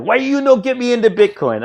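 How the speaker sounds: high-pitched mock broken-English accent; imitated accent. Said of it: "and this guy has an accent".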